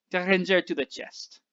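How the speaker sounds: put-on French accent, drawing out a dry aside. A narrator's reading. Abraham adds a wry remark to the driver, French accent. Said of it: "said the man with the French accent".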